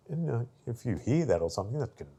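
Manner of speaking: normal talking voice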